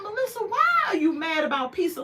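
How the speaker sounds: high, exasperated question; incredulous. Retold or imitated. Vlogger talking back to Melissa, incredulous. Host scolds Melissa about pizza.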